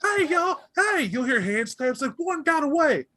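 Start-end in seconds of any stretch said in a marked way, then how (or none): 0.0-1.0 very high, shouting over; rally the others; high yelling kid; calling the crew. Acted. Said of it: "start this argument". 2.2-3.1 very high call across the lawn; high yelled alarm; alarm shout. Acted. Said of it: "starts shouting it across the lawn".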